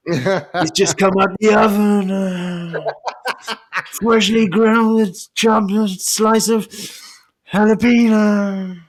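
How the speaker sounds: high, slow hammed-up wrestler voice; bad acting. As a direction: ham it up like a wrestler.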